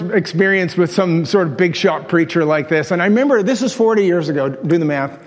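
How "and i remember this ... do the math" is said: ordinary talk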